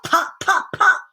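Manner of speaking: high, bouncy pops; popping sounds. A narrator's reading. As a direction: snap each pop out. Buttons bursting sound.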